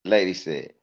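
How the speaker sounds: usual speaking voice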